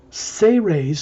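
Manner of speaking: "Say Ray's" as two clear syllables, say and rays; shown pronunciation. Shown as a demonstration. Guide naming the book for listeners, "Say Ray's" pronounced say-rays. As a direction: say "Say Ray's" like the English words say plus rays. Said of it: "is pronounced".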